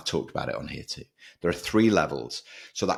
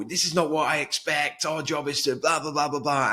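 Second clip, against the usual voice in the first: retold angry rant, pitched high and louder; mock a boss losing his rag at the team; boss going off; 11 semitones higher, 3 dB louder than usual. Boss ranting at his team about expectations, imitated high angry rant. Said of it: "he would just go off on one".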